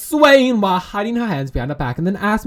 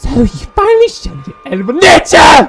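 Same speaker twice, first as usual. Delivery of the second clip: much louder and higher, jeering; furious shouting; 8 semitones higher, 11 dB louder than usual. Acted. A dream tormentor jeering at Anton, furious shouting. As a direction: spit the insults at him.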